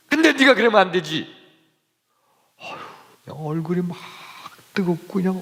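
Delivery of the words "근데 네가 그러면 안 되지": higher, stern reproach on 근데; scolding. Retold or imitated. Keeper scolds the narrator alone, raised stern voice. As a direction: scold him for shaming his father. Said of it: "나만 더 야단치는 거예요".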